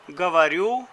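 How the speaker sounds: usual speaking voice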